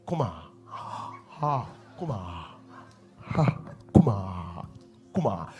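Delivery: low, soft mimicked syllables; mock tongues syllables. Preacher mocking a believer forcing syllables, low and soft. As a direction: imitate someone forcing tongues syllables softly.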